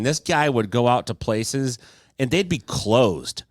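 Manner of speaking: ordinary delivery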